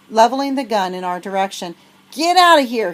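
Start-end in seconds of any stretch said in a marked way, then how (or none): 2.1-2.9 put-on high, loud old-man shout; raspy old man shouting. Old farmer shouting at the girls with his shotgun. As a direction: bark the farmer off his land. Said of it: "he shouted in his raspy old voice".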